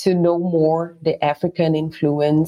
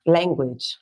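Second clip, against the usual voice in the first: ordinary talk, nothing marked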